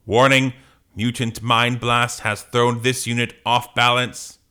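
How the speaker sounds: robot voice. Acted. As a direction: announce it like an alarm system. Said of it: "Are you gonna do a robot voice?".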